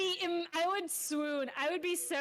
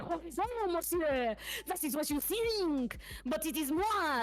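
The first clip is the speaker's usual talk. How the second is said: fake French accent; 4 semitones higher than usual. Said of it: "my fake French [name] impression".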